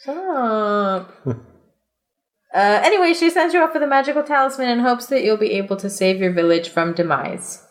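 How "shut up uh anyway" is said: ordinary talk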